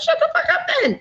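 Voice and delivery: high, laughing mock put-down; laughing retort. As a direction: crack up while delivering the zinger. Punchline to daughter, laughing.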